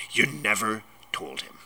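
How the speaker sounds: contained, trembling rage. A narrator's reading. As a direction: hold the fury in, barely. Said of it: "his every syllable trembled with rage".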